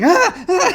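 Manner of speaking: sharp high yelps, loud; short loud cries of sudden pain; pained yelps as his coat burns. Acted. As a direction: yelp as the flames catch his coat. Coat catches on fire.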